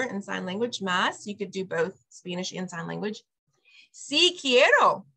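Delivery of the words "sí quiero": "Sí quiero" stretched slowly and a touch higher; careful, slow "Sí quiero"; slow model phrase. Shown as a demonstration. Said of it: "Or your child might say".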